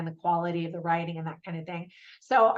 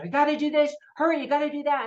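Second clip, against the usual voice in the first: higher, lightly acted nagging pressure; mildly pushy, raised-pitch quote; mock urging; 6 semitones higher, faster than usual. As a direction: imitate someone hurrying a colleague along. Voices the always-hurrying husband.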